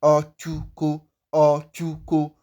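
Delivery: slow, drawn-out "Ochuko" with clear ch; careful model pronunciation. Shown as a demonstration. Teacher asking learners to listen, careful ch in Ochuko. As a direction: stretch "ochuko" so the ch stands out. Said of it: "listen to me to really get how it is pronounced".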